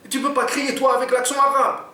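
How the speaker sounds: high, insistent voicing; Jean pressing Oumar to shout; pushy robber. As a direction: press your friend to do it.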